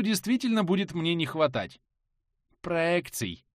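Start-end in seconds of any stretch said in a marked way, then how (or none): none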